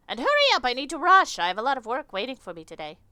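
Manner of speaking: impatient insect lady. Acted. Insect customer rushing the clerk, high impatient voice. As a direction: hurry the clerk like a busy matron.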